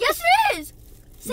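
high, loud excited yes; excited insisting. Real, not acted. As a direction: insist on it, thrilled. Kid insists about tooth.

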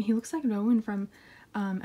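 ordinary talk, nothing marked

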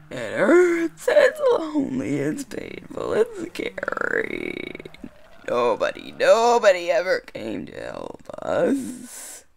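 weepy then hollow ghostly lament; crying voice. Acted. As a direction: wail like a lonely ghost child. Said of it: "A crying voice?".

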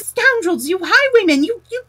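high, loud indignant tirade; furious shouts, stumbling on the insults; outraged shouting. A narrator's reading. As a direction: rant at them, spluttering with rage. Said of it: "simply transported with passion".

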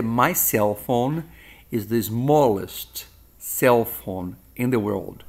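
"My cell phone is the smallest cell phone in the world" spoken slowly and clearly; slow model sentence. Shown as a demonstration. Teacher modelling an English sentence for students, slow and clear. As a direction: read the example slowly for learners. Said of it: "eu posso dizer".

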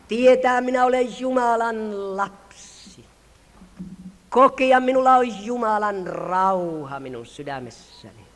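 full-voiced revival preacher, slow and building; fervent preaching. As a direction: preach it over the hall with rising fire.

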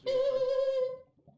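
high, slow fake laughing; hammed-up fake laugh. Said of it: "That was a fake laugh".

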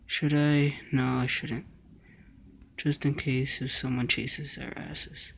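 ordinary talk, nothing marked

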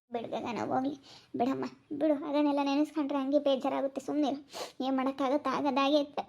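soft, resigned comforting; soothing consolation. Real, not acted. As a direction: softly hush someone who is upset. Consoling a crying relative.

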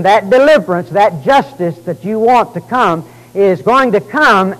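rising preacher cadence, loud and emphatic; preaching. Acted. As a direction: preach it with full conviction. Preacher expounding scripture.

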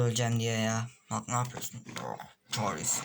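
ordinary talk

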